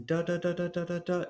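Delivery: even "da" syllables on one high pitch; high monotone run of "da", gently tongued; soft D tonguing. Shown as a demonstration. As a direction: repeat "da" with a soft D, pitched well above talk. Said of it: "harsh articulation and a more soft one".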